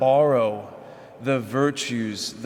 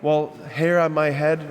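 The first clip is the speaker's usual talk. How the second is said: indignant disbelief; 5 semitones higher than usual. Retold or imitated. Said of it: "we would think".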